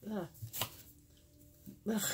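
groans while getting something out of her mouth; ugh, something stuck in the mouth; clearing mouth. Real, not acted. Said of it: "Had something in my mouth".

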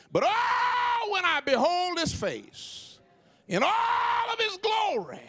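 high, slow shouted preaching; preaching at full force. Acted. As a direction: soar on his glory. Preacher climaxes on glory.

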